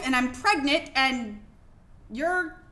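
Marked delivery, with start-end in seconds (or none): none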